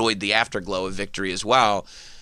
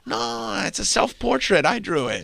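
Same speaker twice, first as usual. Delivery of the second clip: mock Rose; 8 semitones higher than usual. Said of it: "Rose answering".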